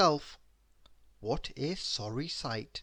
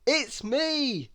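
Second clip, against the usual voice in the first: higher, louder call; 10 semitones higher, 4 dB louder than usual. A narrator's reading. Mister Happy calls to Miss Giggles at the door. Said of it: "called out Mister Happy".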